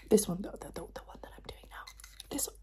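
halting, repetitive soft whisper; ASMR whisper. Pretend. Doctor asking patient about a sound, whispered. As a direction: whisper in stuttered repeats.

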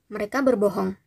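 plain reading voice

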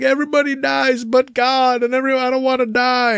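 imitated kid's wail, pitched very high; high, tearful child impression, sustained to the end; weepy child. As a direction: cry it out like an upset little girl. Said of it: "kind of being real weepy".

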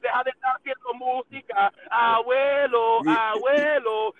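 slow, drawn-out, loud calls of abuelo; stretched-out mocking "abuelo"; mocking grandpa call. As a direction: taunt him like an old man.

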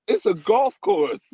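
mock protest. Arguing back about cutting the golf course, mock exasperation. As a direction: stretch it out in pleading disbelief. Quoting own protest to accusers.

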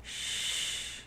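soft unvoiced hush; whisper. A narrator's reading. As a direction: breathe a gentle shush. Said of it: "Anna heard a whisper".

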